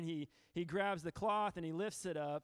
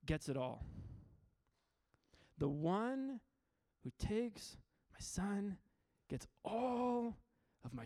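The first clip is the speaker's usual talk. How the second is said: slow, drawn-out proclamation with long pauses; solemn will reading; slower than usual. Acted. Reads painter's will aloud, solemn climax.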